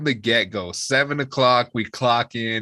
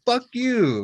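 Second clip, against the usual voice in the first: much higher, indignant mock retort; offended comeback; 4 dB louder than usual. Mom answering her drunk son's insult, offended. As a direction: imitate an affronted mom firing back. Mother snaps back at drunk son.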